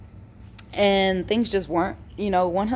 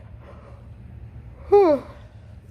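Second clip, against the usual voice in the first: long breathy sigh; 13 semitones higher than usual. Real, not acted. Tired vlogger sighs mid-story.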